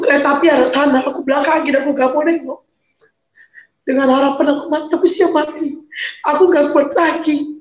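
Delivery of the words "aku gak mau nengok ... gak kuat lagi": ordinary talk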